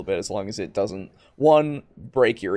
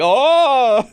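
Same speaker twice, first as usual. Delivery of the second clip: mock alarm; 13 semitones higher, 9 dB louder than usual. Mock alarm at floating rocks.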